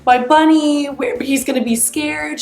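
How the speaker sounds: a child's mock cry over a lost toy; imitating a crying child. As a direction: mimic a toddler sobbing for her bunny. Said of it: "she starts crying and she's just like".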